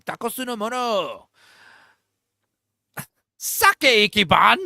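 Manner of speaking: high, loud fake Japanese gibberish; hammed-up mock Japanese babble; fake foreign language. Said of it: "Fake Japanese is so fun".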